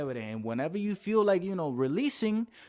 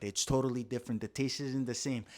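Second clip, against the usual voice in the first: normal talking voice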